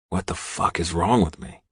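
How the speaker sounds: usual speaking voice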